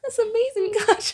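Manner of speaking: high, playful fangirl gush; hamming up the amazement; mock gushing. Mock gushing at Kacchan's cooking.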